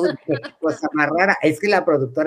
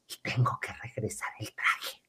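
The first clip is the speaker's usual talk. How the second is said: soft, lower, breathy whisper; whispered question; 9 semitones lower, 15 dB quieter than usual. Retold or imitated. Naive planner whispering about returning the dress. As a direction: ask it in a hushed, innocent whisper.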